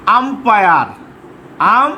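Umpire drawn out in two parts, আম and পায়ার; slow dictation. Shown as a demonstration. Teacher dictating umpire to pupils, slow and deliberate. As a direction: say umpire slowly and fully voiced.

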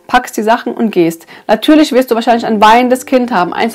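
ordinary talk, nothing marked

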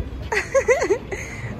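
short high-pitched laugh; high giggle. Real, not acted. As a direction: giggle after saying hello. Laughs after greeting the cousins. (ضحكة رقيقة)

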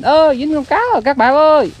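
loud, high fake cry of triumph; mock hollering. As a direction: imitate someone raising a hue and cry over a catch. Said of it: "Tóm xong cái la làng lên". (giả giọng la làng, cao và to)

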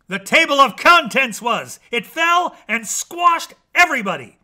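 high, loud dramatic outburst; big emphatic climax; exclaiming. A narrator's reading. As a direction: shout the punchline in exasperation. Narrator blurts the disastrous ending.

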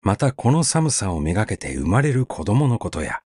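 usual speaking voice

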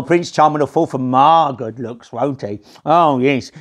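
ordinary delivery

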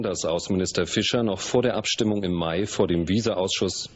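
ordinary talk, nothing marked

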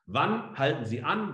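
ordinary delivery

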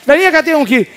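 amazed prophet. Acted. Samuel amazed at the tall son.

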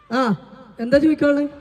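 usual speaking voice

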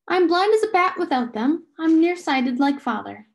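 high, loud explanation; emphatic reply. A narrator's reading. Meg answers about her glasses.

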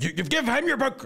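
high-pitched imitation of a classmate; squeaky mock protest; retold classmate outburst.

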